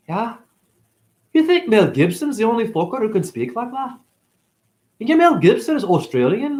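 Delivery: high-pitched put-on Scottish accent. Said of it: "thank you for my Scottish accent".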